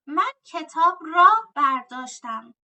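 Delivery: را said loud and drawn out; teacher marks the object ending را; stress on را. Shown as a demonstration. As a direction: lean hard on the word را. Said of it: "teacher stresses را in example".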